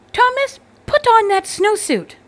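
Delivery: high, loud frantic yell; a mother at the end of her rope; exasperated yell. A narrator's reading. Mother jumping up and down, yelling at Thomas. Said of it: "His mother jumped up and down".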